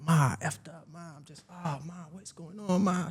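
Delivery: high, soft acted whimper; distraught plea. Crying son on the phone to mom, soft distraught plea.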